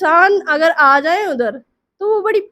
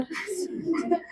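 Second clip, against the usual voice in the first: short laugh; 4 semitones higher, 14 dB quieter than usual. Real, not acted. Teller laughs mid-story.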